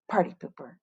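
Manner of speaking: very soft, low muttered aside; muttered under breath. A narrator's reading. Victoria complaining about the teacher, muttering. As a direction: grumble it under your breath. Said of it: "muttered Victoria under her breath".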